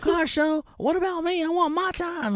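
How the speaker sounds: very high, whining mock wife; hammed-up nagging wife voice; whiny wife. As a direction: whine like a neglected wife.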